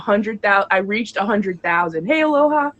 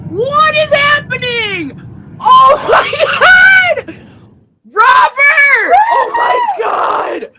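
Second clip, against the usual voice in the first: much higher, loud, slow screams of disbelief; ecstatic screaming; 7 dB louder, slower than usual. Real, not acted. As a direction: shriek with pure joy and shock. Host reacts to a huge gift.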